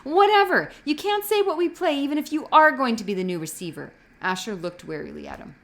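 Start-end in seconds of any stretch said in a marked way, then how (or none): 0.0-3.9 loud, high, fast lashing out; angry outburst. A narrator's reading. Asher lashes out at Jonas about the game, loud and fast. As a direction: snap back at him in anger.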